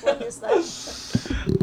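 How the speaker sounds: high, slow, through laughter; laughing question. Real, not acted. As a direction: ask it while laughing. Laughing at the grandson's act.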